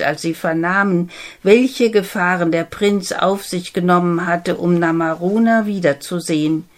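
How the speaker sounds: ordinary delivery, nothing marked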